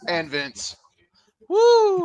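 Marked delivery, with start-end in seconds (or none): 1.5-2.0 very high, loud wrestling woo; Flair woo. Retold or imitated. Calling back to the wrestling legend, loud high woo.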